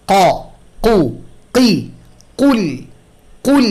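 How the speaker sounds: slow drill: ক, কু, কি, কুল; drilling the Arabic qaf. Shown as a demonstration. Quran teacher drilling students: কুল from the back of the tongue. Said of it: "তো এটা practice করতে হবে".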